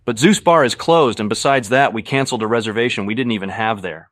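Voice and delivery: loud, barely keeping calm; strained calm. A narrator's reading. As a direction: force calm through rising anger. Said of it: "trying to stay calm".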